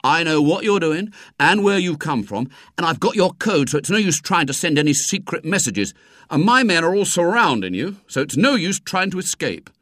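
self-important schoolboy menace; darkly accusing. A narrator's reading. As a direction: confront the spy, sure you have him cornered. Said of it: "said William darkly".

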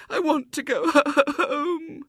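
high, slow, broken wail; wailing little girl. A narrator's reading. Homesick girl longing to leave, loud sobbing wail. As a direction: sob through the last word, stretching it. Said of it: "wailed the little girl".

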